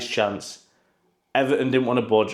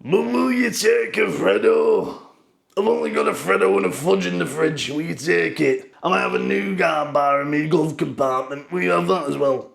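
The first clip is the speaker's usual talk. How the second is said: haggling Ratcliffe; 4 semitones higher than usual. Retold or imitated. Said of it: "He'd be like".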